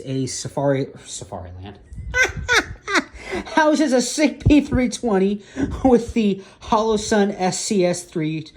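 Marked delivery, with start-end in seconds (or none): 1.8-3.5 high-pitched helpless laugh; real squeaky laughing fit; laughing at own slip. Real, not acted. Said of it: "I'm laughing at my own error". 3.6-8.5 high, loud, drawn-out words through laughter; real laugh breaking into the speech; laughing at own error. Real, not acted. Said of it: "I'm laughing at my own error".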